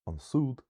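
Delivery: "an súd" with "súd" high and weighted; quick "an", jump up onto "súd"; stress on second syllable. Shown as a demonstration. Teacher pronouncing it as learned, second-syllable stress. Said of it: "secondary stress".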